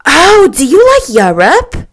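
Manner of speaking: high, loud, eager question; excited squeal of interest; surprised exclamation. A narrator's reading. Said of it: "she exclaimed surprisingly".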